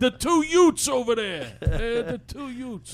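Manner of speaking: put-on New York accent. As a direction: quote My Cousin Vinny's "Utes". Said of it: "My Cousin Vinny quote".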